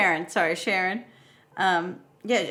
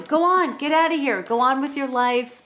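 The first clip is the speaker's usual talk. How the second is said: higher, louder shooing-on voice; mock urging; 7 semitones higher, 4 dB louder than usual. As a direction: push him along with mock enthusiasm. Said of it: "She tells him".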